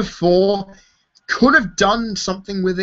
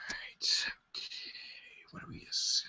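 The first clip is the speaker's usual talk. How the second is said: whispering; 21 dB quieter than usual. Real, not acted. Exhausted late-night streamer.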